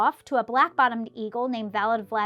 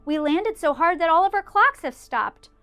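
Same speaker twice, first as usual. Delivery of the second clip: pleading mayor; 5 semitones higher, 5 dB louder than usual. A narrator's reading. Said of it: "pleaded that voice of the mayor".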